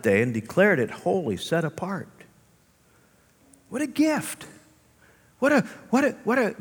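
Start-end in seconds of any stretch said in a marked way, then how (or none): 3.7-6.5 awed exclamation. Real, not acted. Preacher marvelling.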